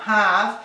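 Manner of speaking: "have" with a soft breathy H, like breath on glass; soft English H. Shown as a demonstration. Teacher shows the soft H of have. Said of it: "un sonido muy suave".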